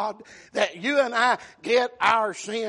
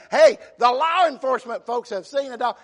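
ordinary delivery

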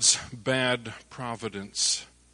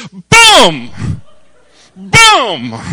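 loud shouted gunshot boom; sudden loud boom of a rifle; 5 semitones higher, 14 dB louder than usual. Retold or imitated. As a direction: bark out the rifle shot. Retold extra gunshots.

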